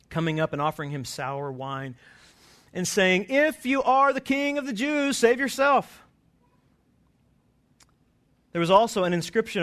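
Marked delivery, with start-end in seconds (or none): 3.3-6.0 high, loud sneering taunt; mocking soldier. Soldiers mocking the crucified king, high loud sneer. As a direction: jeer it up at the cross. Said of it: "the soldiers also mocked him".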